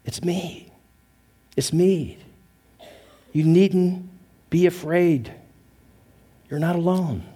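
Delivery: tender reassurance. Acted. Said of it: "Jesus' message to you is this".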